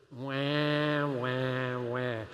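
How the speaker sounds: drawn-out mock crybaby wah; whiny baby wah. Shown as a demonstration. Congregation practicing the carol words, mock crybaby wah. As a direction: lead the room in a teasing wail. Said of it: "Let's practice it".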